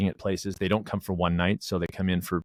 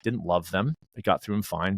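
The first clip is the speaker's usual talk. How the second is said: normal talking voice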